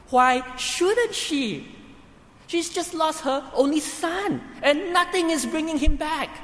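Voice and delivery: impassioned. Real, not acted. Preacher pleading widow's case.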